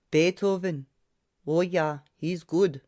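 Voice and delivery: bright chirp in a thick Slavic accent, lightly voiced; chirpy Slavic accent. A narrator's reading. Old barber answering the maestro cheerfully, chirpy Slavic accent. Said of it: "the barber chirped".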